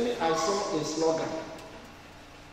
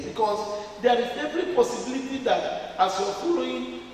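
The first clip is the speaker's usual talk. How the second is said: normal talking voice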